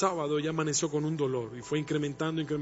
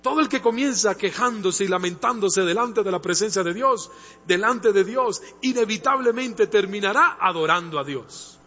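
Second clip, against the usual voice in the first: loud, fervent sermon delivery; full-force Spanish preaching; 4 semitones higher, 6 dB louder than usual. Acted. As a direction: preach it out to the whole church. Preaching to the congregation.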